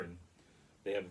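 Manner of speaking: normal talking voice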